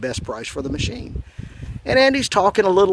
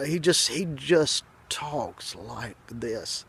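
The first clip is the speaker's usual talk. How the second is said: slow, dragged-out words, lower in level; very slow talker; 3 semitones lower, 7 dB quieter, much slower than usual. Retold or imitated. Describing his slow-talking buddy, imitated dragging slowness. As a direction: imitate a buddy who talks painfully slowly. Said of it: "you just can't believe how slow he is".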